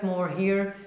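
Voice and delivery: ordinary delivery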